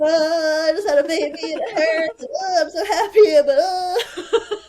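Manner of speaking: overwhelmed mother crying with joy; laughing through tears. Retold or imitated. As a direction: relive holding the newborn, sobbing and laughing. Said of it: "I'm sobbing".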